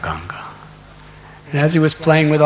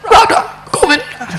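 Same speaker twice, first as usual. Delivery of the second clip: much higher, louder puppy yelp of Radha Govinda; 10 semitones higher, 5 dB louder than usual. Shown as a demonstration. Showing how the puppy chanted, in a high yipping puppy voice. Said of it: "How do puppies say it?".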